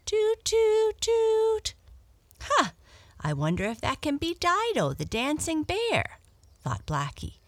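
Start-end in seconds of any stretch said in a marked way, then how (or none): 0.0-1.8 high, loud horn toots; tooting horn, held high and loud. A narrator's reading. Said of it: "she heard a horn blow". 2.4-6.2 high, fast and eager; a surprised cat hoping for a friend; excited cat, much higher; excited wondering. Acted.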